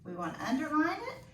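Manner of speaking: normal talking voice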